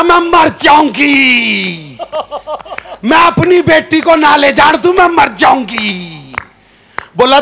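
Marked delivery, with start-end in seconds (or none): 0.0-6.3 slow, drawn-out hammed-up wail; cling and wail melodramatically; slow exaggerated lament, only half serious; mock wailing aunt.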